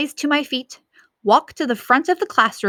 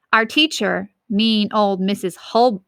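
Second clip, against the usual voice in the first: plain reading voice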